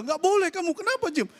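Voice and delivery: very high, agitated mock scolding; re-enacted squealed objection; appalled protest. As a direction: forbid it in comic horror. Appalled protest in retold story.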